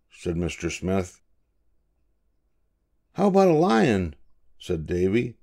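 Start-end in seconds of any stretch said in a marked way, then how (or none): 3.1-4.1 lightly voiced eager boy, pitched high; a grandpa reading young Davy's curious question up high. A narrator's reading. Grandpa voices boy Davy asking his father, high and eager.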